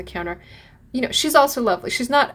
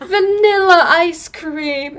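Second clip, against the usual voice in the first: slow, loud, higher delighted cry; excited quote; 7 semitones higher, 8 dB louder, much slower than usual. Retold or imitated. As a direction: call it out with joy. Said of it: "And they're going, like".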